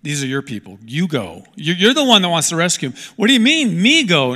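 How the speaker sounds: incredulous objection that climbs to a near shout; protesting Moses. Acted. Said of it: "I'm sure Moses was like".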